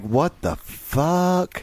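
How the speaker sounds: very slow, stunned; stunned drawl. Retold or imitated. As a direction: drag it out in disbelief.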